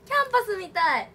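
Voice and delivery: excited キャンパスみたい. Real, not acted. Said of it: "キャンパスみたいな".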